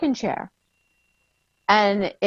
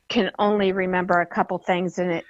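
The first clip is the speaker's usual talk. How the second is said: usual speaking voice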